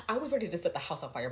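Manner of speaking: usual speaking voice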